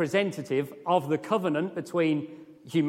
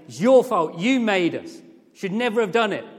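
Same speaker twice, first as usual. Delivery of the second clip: loud, raised accusation; mock angry blame; 6 semitones higher, 8 dB louder than usual. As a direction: shake your fist at the sky as you say it. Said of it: "shake our angry fist at God".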